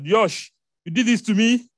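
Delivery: loud, high mock outrage; hammed-up scolding that tips into laughter; mock accusation. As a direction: call out in playful indignation. Teasing mock accusation to congregation.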